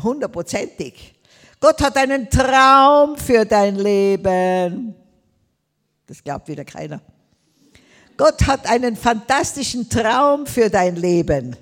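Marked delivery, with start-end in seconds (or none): 1.6-11.6 emphatic proclamation. Real, not acted. Preacher proclaims to congregation.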